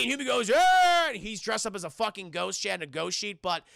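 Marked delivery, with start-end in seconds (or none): 0.5-1.1 loud, high held holler; startling yell. Retold or imitated. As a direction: burst out yelling to scare them. Hubie leaps out yelling.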